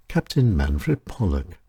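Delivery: usual speaking voice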